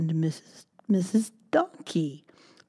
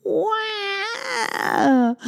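put-on high, piercing baby cry; baby wail. Baby mouse wails in the dark, high piercing cry. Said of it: "cried Baby Mouse".

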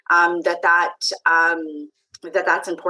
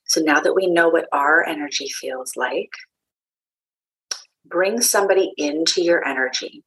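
calm meditation guide with long pauses; unhurried, grounding voice guiding listeners inward; guided meditation. Acted. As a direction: lead a meditation, leaving space between phrases.

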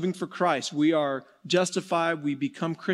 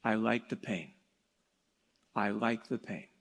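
soft low quote; 7 semitones lower, 9 dB quieter than usual. Retold or imitated. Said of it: "the neighbor would always say".

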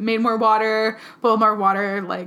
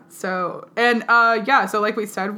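ordinary talk, nothing marked

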